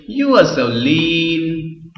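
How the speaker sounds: high, very drawn-out mock compliment; slow, teasing irony; ironic teasing. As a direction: stretch the last word in feigned admiration. Acted ironic remark to fat man.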